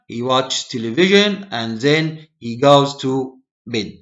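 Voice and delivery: teacher's deliberate passage reading; reading aloud for learners. Acted. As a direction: read it out phrase by phrase for English learners, pausing between chunks. Reads the lesson passage aloud.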